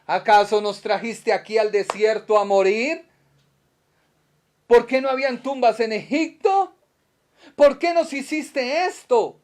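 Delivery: loud, high whining complaint; complaining Israelites. Acted. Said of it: "Le dijeron a Moisés".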